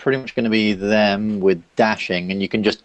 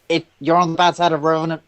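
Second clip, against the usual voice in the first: high, quick character voice; Cathaniel; 8 semitones higher, faster than usual. Acted. Character warning the party about revenants, high, quick voice. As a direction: pitch it well up and say it briskly. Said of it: "that's all he says".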